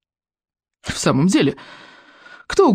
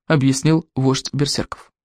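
ordinary delivery, nothing marked

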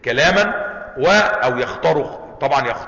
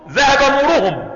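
"ذهب نورهم" said much higher and slower; raised example phrase; 10 semitones higher, 4 dB louder than usual. Shown as a demonstration. Teacher contrasting the original phrase for students, high and slow. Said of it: "وأصله".

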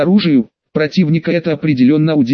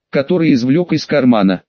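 ordinary delivery

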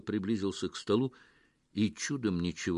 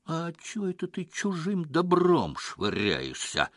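high, indignant question; prickly, raised-pitch objection; bristling challenge; 7 semitones higher than usual. A narrator's reading. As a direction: challenge them, hackles up. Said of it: "ощетинившись".

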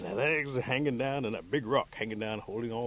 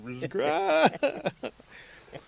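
high, loud, broken cry; agonized wail; 7 dB louder than usual. Acted. Rabbit hanging all night from the tree, high agonized wail.

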